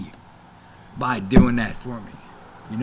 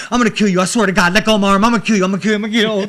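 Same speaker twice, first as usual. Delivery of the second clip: loud, higher, rapid-fire repeated threats; mock furious threats; 6 semitones higher, 7 dB louder, much faster than usual. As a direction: imitate an enraged wrestler threatening murder. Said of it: "She's going".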